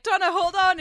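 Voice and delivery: loud urgent call; shouting. Retold or imitated. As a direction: call out to her. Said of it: "where he's like".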